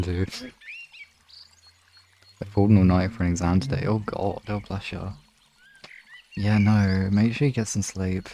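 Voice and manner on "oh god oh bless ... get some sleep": ordinary talk, nothing marked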